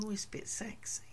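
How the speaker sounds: breathy whispered thought, barely voiced; hushed private whisper.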